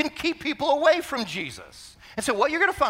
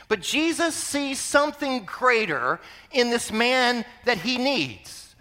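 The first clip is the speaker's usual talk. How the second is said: usual speaking voice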